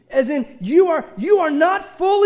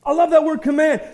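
ordinary talk, nothing marked

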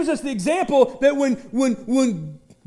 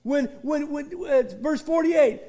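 ordinary talk

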